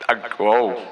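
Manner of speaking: glitchy robotic voice. Real, not acted. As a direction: sounds like a malfunctioning robot. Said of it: "I sound like I was a robot there".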